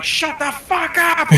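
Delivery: high, slow mock yell down the platform; imitated yell. Stranger on the platform yelling at nobody, mock yell. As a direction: stretch it into a high drawn-out shout. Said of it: "just going".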